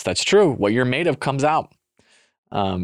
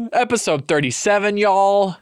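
excited; 8 semitones higher, 3 dB louder than usual. Acted. Hammed-up intro.

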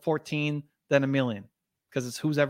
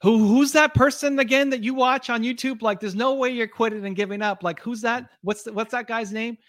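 higher, louder urgent mom voice; mother urging daughter; 8 semitones higher, 5 dB louder than usual. Retold or imitated. Mom at hospital bed, urging daughter to keep going, higher and louder. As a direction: push her not to give up, pitched up. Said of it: "her mom came in with the iPad and said".

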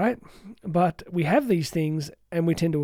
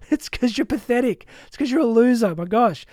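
higher, louder sneering voice of your own brain; the brain mocking its owner, higher and louder sneering; mocking inner critic; 6 semitones higher, 4 dB louder than usual. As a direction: taunt yourself like a smug bully. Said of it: "your brain goes".

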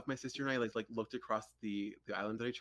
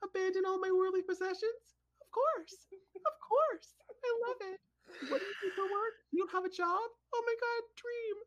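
high-pitched, gushing mock heroine voice; mocking movie heroine. Daughter mocking the movie heroine during dad's film. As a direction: ham up a starry-eyed movie heroine. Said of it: "I'm like".

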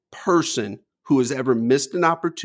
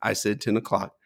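low, soft, unruffled firmness; calm; 6 semitones lower, 6 dB quieter than usual. Retold or imitated. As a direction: imitate an uncle who never needs to yell. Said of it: "he didn't miss a step".

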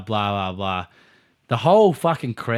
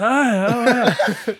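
laughing; 10 semitones higher, much slower than usual. Retold or imitated. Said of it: "I was like".

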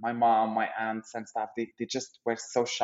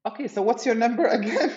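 higher voice breaking into a laugh; laughing awkward request; 9 semitones higher than usual. Retold or imitated. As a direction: act out an awkward ask, cracking up.

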